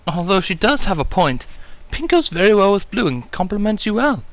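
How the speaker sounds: high, refined lady voice, coaxing; Rarity. A narrator's reading. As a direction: persuade him sweetly.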